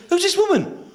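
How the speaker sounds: high-pitched suspicious demand; hammed-up affronted question; indignant challenge. Latecomer challenges the stand-in.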